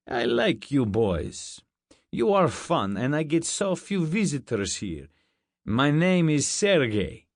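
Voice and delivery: put-on thick Russian accent. A narrator's reading. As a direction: introduce yourself in a heavy Russian accent. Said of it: "He had a thick Russian accent".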